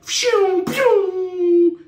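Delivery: high, loud whistling "pshew"; imitated blast whoosh. Attacker gets thrown into the bathroom, blast sound effect. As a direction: shoot out two piercing whooshes, second drawn out.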